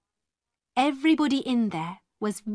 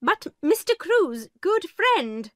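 Miss Minchin protesting; 8 semitones higher than usual. A narrator's reading. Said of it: "began Miss Minchin".

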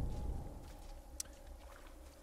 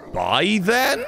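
normal talking voice

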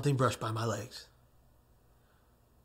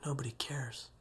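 muttered; 11 dB quieter than usual. Retold or imitated. Man muttering to himself at the rail, barely audible. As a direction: mutter it under your breath. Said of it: "I muttered".

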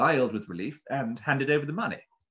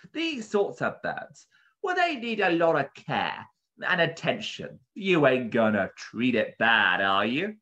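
higher-pitched shopkeeper, pressing the customer; protective bird keeper; 5 semitones higher, 4 dB louder than usual. A narrator's reading. Bird keeper warning a buyer about a rare parrot, earnestly. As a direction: stress how precious the bird is.

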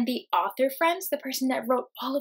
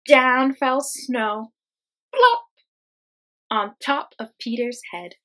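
big plop; 10 dB louder, slower than usual. Acted. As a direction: drop the snow with a big plop. Snow falls on Peter.

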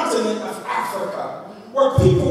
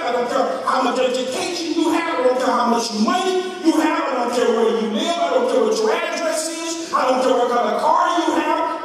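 preaching at full force; 4 semitones higher than usual. Acted. Preacher rousing the church with Obama's slogan. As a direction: build the crowd up as you preach.

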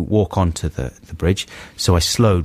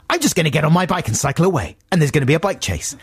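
scheming thought; 7 semitones higher, faster than usual. Retold or imitated. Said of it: "I was thinking".

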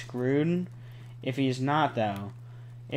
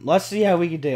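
normal talking voice

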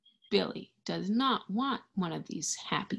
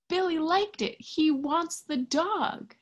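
delighted; 9 semitones higher than usual. Acted. Happy turn in the story.

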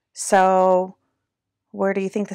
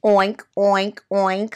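storybook pig oinking; short, snorted pig calls; snorting oinks; 4 dB louder than usual. A narrator's reading. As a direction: oink like a pig. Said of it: "snorted Pig".

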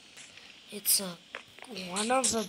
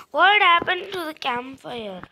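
raised, high and loud, baffled; loud confused call; 6 semitones higher, 10 dB louder than usual. Real, not acted. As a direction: shout it in disbelief. Campfire vanished.

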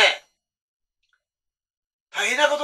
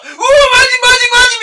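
very high, very loud panicked shouting; terrified shriek; 11 dB louder than usual. Retold or imitated. Driver hearing a voice from the empty back seat, shrieking in terror. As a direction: scream in sheer fright at the voice behind. Hears ghost voice behind.

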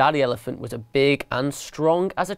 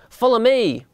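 called order; 8 semitones higher, 4 dB louder than usual. A narrator's reading. Boss mouse orders his gang.

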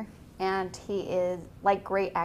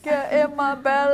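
a hint of the Scottish glutton, a bit higher; Austin Powers character; 5 semitones higher, 7 dB louder than usual. Quoting the Austin Powers villain to friends, mock Scottish. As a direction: lightly growl it like the greedy Austin Powers villain. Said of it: "that Austin Powers character".

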